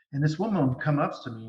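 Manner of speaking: usual speaking voice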